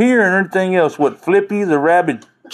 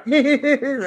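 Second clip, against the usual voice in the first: demo laugh; 10 semitones higher, 3 dB quieter than usual. Shown as a demonstration. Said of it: "That's more of a".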